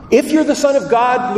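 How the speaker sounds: high, loud devil's taunt; taunting Lucifer. Acted. Preacher voicing Lucifer taunting Jesus, high mock challenge. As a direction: sneer it up high like the tempter. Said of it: "Lucifer says".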